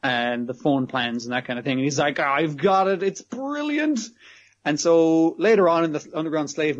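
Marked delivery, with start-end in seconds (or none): 2.2-4.0 excited, "brilliant" rising high; mock eureka. Otsuki spotting the phone ad, mock eureka. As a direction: act out a sudden bright idea. Acts out sudden idea.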